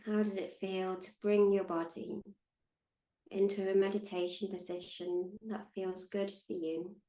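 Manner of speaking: calm, unhurried meditation guide with long pauses; measured, soothing meditation voice; guided meditation. Acted. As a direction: guide them gently into stillness, leaving space between phrases.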